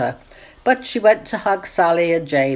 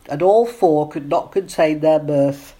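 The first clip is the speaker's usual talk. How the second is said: usual speaking voice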